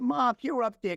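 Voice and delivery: retold appeal to a mother in heaven; calling out. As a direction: call upward to get her attention. Calling up to his late mother.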